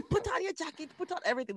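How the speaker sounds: high mock fussy parent; mother fussing over her child's jacket; fussing mom. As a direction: nag like a worried mother. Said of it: "I'm the one who's like".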